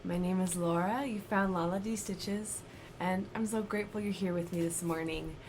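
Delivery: ordinary delivery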